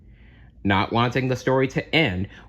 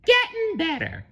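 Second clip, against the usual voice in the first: very high cartoon cheer; SpongeBob encouraging; 4 dB louder than usual. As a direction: encourage brightly, pitched way up. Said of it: "SpongeBob said encouragingly".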